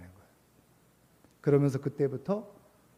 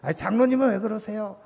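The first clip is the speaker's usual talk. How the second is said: high, louder mock complaint; accusing; 7 semitones higher, 7 dB louder than usual. Church members picking at each other and the elder, acted-out accusing. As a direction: act out indignant fault-finding. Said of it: "자꾸 이러기는 것보다".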